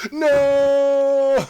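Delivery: long, high, hammed-up scream; mock anguished yell. Actor throwing hands to the sky over a dead panda, melodramatic yell. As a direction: yell it out over-dramatically, very loud and drawn out. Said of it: "and he yells".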